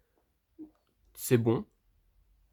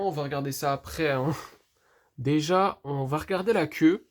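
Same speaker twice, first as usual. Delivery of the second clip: usual speaking voice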